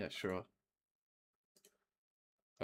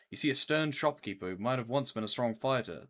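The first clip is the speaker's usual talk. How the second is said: usual speaking voice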